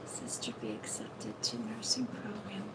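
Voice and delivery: soft, half-whispered read; reading under breath. Real, not acted. Reader reading a viewer's question to herself, under her breath. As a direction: murmur it as you read.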